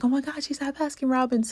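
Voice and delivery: put-on quick, high, thrilled retelling of a child; excited child. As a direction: blurt it out like a thrilled little girl. Child excited about mom's work.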